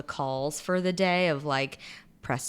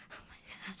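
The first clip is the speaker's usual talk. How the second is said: barely voiced, retold awestruck reaction; stunned whisper; 20 dB quieter, much faster than usual. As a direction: whisper it, stunned, as if lost for words. Retold stunned reaction to dirty email.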